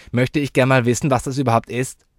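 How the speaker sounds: put-on loud, high child's voice; inquisitive child. Child wanting an explanation, loud and high. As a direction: demand to know in a high, loud voice.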